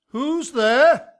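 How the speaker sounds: loud, high call toward the door. Acted. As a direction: call out sharply to the knocker at the door. Friar calls out at a knock.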